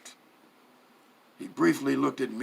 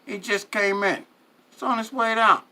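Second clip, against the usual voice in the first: higher and louder, urgent henchman report; tall man's reply; 11 semitones higher, 7 dB louder than usual. A narrator's reading. Tall henchman reporting to boss, high loud urgent reply.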